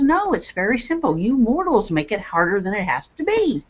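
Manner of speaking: a hint of a scolding sister from beyond; sister's spirit voice. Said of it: "she says".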